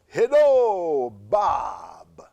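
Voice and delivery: loud hello, whispered Bob; a voice calling from nowhere; mysterious voice. Retold or imitated. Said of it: "I heard a voice say".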